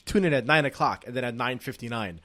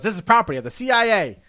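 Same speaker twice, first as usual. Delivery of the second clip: fast, louder gangster line; 7 semitones higher, 7 dB louder, faster than usual. Retold or imitated. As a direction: bark it like a twenties gangster. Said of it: "He goes".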